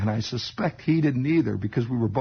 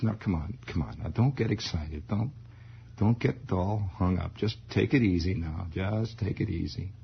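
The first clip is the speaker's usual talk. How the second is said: lower, soft coaxing; a hint of a calming father; 5 semitones lower, 3 dB quieter than usual. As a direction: soothe him lightly.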